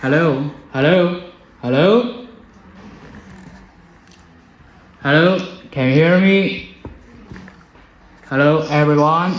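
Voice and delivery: loud test calls heard over the intercom channel; intercom mic check. Real, not acted. Presenter testing the mesh intercom channel, calling out loudly. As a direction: call out hello down the intercom to check it works. You can hear that.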